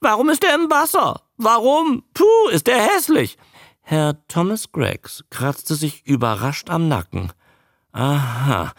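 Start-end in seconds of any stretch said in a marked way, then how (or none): 0.0-3.4 high, squawking creature voice; high-pitched parroting croak; talking newt. A narrator's reading. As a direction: rattle off overheard remarks as a newt. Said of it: "und sagt".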